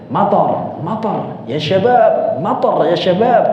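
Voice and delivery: playfully over-Arabic, stretching ya syabab. Retold or imitated. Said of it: "Yang dipanjangin ya syababnya".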